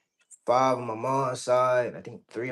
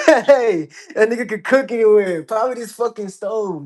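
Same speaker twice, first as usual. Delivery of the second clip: higher and louder, still laughing through the words; laughing excitedly; 10 semitones higher, 11 dB louder than usual. Real, not acted. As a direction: gush about the stove through a laugh. Laughing, hyped about the stove.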